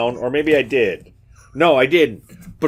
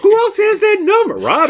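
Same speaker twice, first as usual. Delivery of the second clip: very high, loud comic protest; incredulous mocking; 5 dB louder than usual. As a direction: squeal the question in amused disbelief. Mocking the surprised phone answer.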